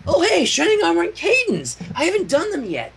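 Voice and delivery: high, bubbly pony voice; eager little pony greeting a doll; cheery Pinkie. Acted. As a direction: chirp it out brightly. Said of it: "ignoring the weird look".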